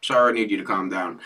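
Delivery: mild imitation of a calm secretary on the phone; calm and soothing. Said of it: "They just stay calm through the whole thing".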